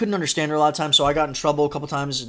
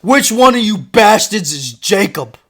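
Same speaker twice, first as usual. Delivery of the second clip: louder, slow, demanding; angry dad; 5 semitones higher, 8 dB louder, slower than usual. Retold or imitated. Dad bursting into the classroom, loud slow demand. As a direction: storm in and demand the kid. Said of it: "My dad smashes open this door".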